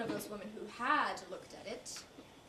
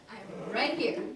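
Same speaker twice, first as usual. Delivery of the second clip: louder, emphatic RIGHT HERE; insistent reply; 7 dB louder, slower than usual. Acted. Woman waving at Bob, insisting loudly she is present.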